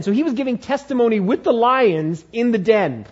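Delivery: ordinary delivery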